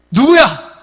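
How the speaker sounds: high, loud, accusing questions; demanding. Retold or imitated. 바람난 남편을 다그치는 아내의 날카로운 추궁. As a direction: confront him like a wife who caught him. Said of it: "막 따지니까요".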